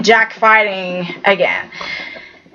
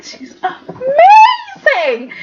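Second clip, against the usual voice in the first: much higher, slow, crying praise; teary gush; 3 dB louder, much slower than usual. Real, not acted. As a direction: break into tears of admiration. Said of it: "I was just so crying".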